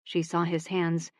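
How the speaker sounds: ordinary delivery, nothing marked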